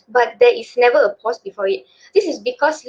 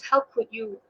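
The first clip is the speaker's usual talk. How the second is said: quieter, prim standard English; formal English; 8 dB quieter than usual. Shown as a demonstration. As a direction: say it properly, like a classy proper girl. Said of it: "I will say out Manglish and English".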